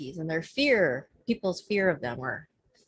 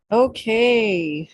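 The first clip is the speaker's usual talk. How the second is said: news reporter voice; 4 semitones higher, 6 dB louder than usual. Real, not acted. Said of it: "I don't know why I get that voice".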